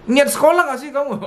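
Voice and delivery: high accusing mock scold. Parent mocking a child's effort at school, high mock scold. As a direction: repeat the nag, sneering. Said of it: "Yang gitu ada loh".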